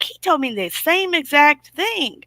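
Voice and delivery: high incredulous. Retold or imitated. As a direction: say it high and slowly, stunned. Retelling her own shock.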